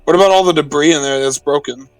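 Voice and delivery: usual speaking voice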